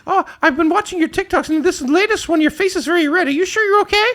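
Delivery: high-pitched imitation of concern; genuinely worried commenter. TikTok viewer worried by Ron's red face, high mock concern. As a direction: play a fretting fan way up high. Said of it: "It, like, seems genuine".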